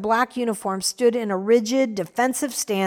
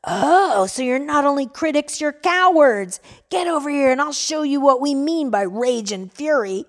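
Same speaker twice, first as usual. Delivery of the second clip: angry shouting; 4 semitones higher than usual. Acted. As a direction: jeer at them as cowards. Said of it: "the voice continued shouting".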